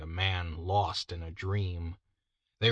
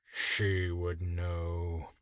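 slow, softer bitter murmur; 3 semitones lower, 4 dB quieter, much slower than usual. Acted. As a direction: say it bitterly, as if to himself. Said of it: "said the leader bitterly".

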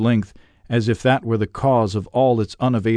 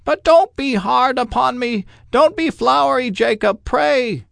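put-on much higher, begging old man; pleading Scrooge; 3 dB louder than usual. A narrator's reading. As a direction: beg him not to be harsh.

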